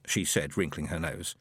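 ordinary delivery

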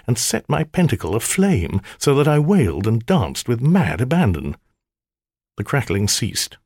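ordinary delivery, nothing marked

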